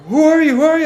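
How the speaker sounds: high, loud repeated demand; cop shouting. Retold or imitated. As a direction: hammer the question like a cop.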